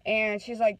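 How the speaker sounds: usual speaking voice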